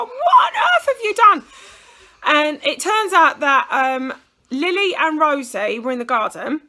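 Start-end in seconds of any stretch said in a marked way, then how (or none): 0.0-1.4 high, loud, slow, laughing disbelief; half-laughing horror at the blood; laughing shocked cry. Retold or imitated. Mum seeing bloodied daughter, laughing shocked shout. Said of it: "I was like".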